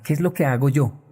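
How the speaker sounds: ordinary talk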